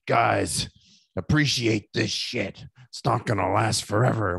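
slow, deliberate, lightly voiced veteran; lightly drawn-out solemn warning; Jake the Snake advice. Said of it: "Jake the Snake ... he goes".

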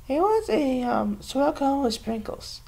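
high, soft imitation of mom; imitating mom. Mom ordering a cone at Dairy Queen, high soft mock voice. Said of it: "my mom would say to them".

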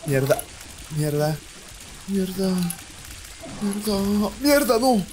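pánico creciente. Real, not acted. Health dropping, rising panic. (mierda cada vez más aguda)

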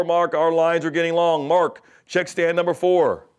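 loud intercom call to a worker; loud store page. Retold or imitated. Store manager calling Mark over the mic, loud. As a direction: belt it out over the store mic, a bit slowed. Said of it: "The guy grabs the microphone and he says".

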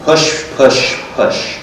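urging push. Retold or imitated. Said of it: "I remember she said".

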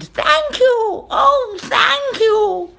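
very high, loud, gushing thanks; high, overjoyed witch voice; grateful witch's cry. A narrator's reading. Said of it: "the grateful witch cried".

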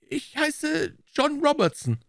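high, slow, halting little man; hesitant. A narrator's reading. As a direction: give a false name haltingly. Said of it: "Der Bursche zögerte einen Augenblick". (zögernd, langsam, mit hoher Stimme)

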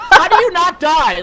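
high, loud, slow disbelief; exasperated shout. Real, not acted. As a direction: yell it slowly, utterly frustrated. Killed in game, exasperated.

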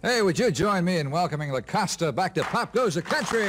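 show announcer. Real, not acted. Host welcoming La Costa back to the show, announcer style. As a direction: call the act onstage with big pitch swings. Host brings singer onstage.

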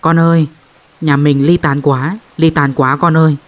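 wailing lament. Retold or imitated. Spirit weeps to the family over its scattered household, wailing. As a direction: wail it like a grieving ghost calling her descendants. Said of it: "có hồn lên kêu khóc thảm thiết".

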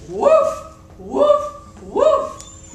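yappy high dog barks; high puppy woofs. Acted. Storyteller barking like a puppy for children. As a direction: bark like a small excited dog.

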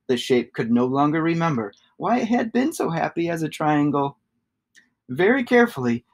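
plain reading voice

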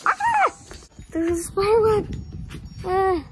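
high startled squeal at a spider web; startled shriek. Real, not acted.

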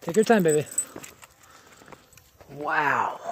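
usual speaking voice

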